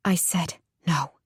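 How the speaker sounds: hushed, unvoiced whisper. A narrator's reading. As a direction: whisper it sharply. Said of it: "Lauren whispered".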